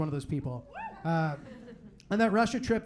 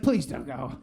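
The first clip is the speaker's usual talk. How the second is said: lower, pleading parent voice; worried parents; 8 semitones lower, slower than usual. Retold or imitated. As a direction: beg him like an anxious parent. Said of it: "they're like".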